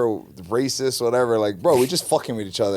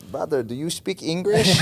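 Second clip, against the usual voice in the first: high-pitched mock Chinese accent; 4 semitones higher than usual. Teasing the new training partner, mock Chinese accent. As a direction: imitate greeting someone in a put-on Chinese accent. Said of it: "this is not their accent".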